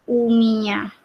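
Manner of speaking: "uminya" said evenly and clearly; pronunciation demo. Shown as a demonstration. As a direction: model "uminya" for learners. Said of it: "Ini bacanya jadi".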